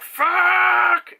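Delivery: loud high cry of effort; shouted curse. Retold or imitated. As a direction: yell it out in battle. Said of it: "she's just like".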